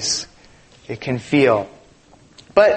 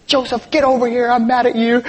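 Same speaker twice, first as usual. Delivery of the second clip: imitating angry wife; 6 semitones higher, faster than usual. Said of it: "She said".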